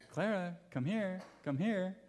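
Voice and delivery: mother coaxing her crying daughter to come; coaxing mom. Retold or imitated. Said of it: "I heard my wife saying".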